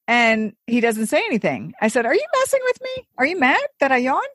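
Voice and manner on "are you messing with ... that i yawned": high-pitched, disbelieving questions; squeaky-high puzzled check; incredulous questioning. Retold or imitated. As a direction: ask a sulking partner, voice way up. Retells asking if he is mad.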